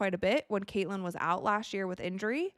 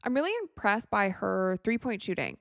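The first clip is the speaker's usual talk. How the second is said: ordinary delivery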